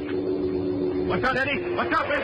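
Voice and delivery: high-pitched cry of alarm; urgent warning. Acted. Gunman warning his partner in a fight, urgent cry. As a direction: yell a warning, voice shooting up.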